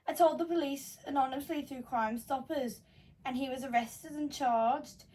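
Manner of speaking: put-on a light Scouse accent on a grim confession; Scouse accent, lightly put on. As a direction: read it like a Liverpool bloke. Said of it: "Are you purposely speaking in a Scouse accent".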